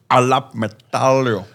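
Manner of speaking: slow, drawn-out put-on accent; mock South African impression. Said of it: "There's no logic to what he says".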